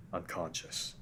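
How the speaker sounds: plain reading voice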